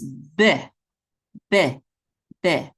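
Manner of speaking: "be" with a quick, short eh; short vowel. Shown as a demonstration. Teacher shows learners the shva sound, short and quick. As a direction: say "be" as a clipped syllable with a short vowel. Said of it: "have a quick eh".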